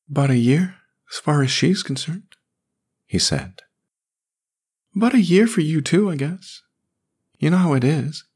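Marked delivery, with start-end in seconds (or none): 0.1-2.4 put-on high, boyish voice of a sad little boy; young boy voice. A narrator's reading. Boy Jamie voiced by narrator. 5.0-8.2 put-on high boyish voice, quick and defensive; a little defensive. A narrator's reading. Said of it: "he added, a little defensively".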